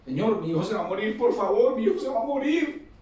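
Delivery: beg, climbing up in pitch; desperate plea. Acted. Father begging Jesus to save his dying son, pleading and rising.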